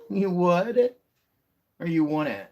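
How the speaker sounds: high, loud pet-talk question. Real, not acted. Owner asking her cat in high baby talk. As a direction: coo up at the cat.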